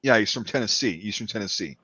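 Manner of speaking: low, understated Tennessee accent; lightly Southern Tennessee, trailing low; subtle Eastern Tennessee accent. Shown as a demonstration. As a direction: keep the Tennessee accent relaxed and soft. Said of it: "subtle yet".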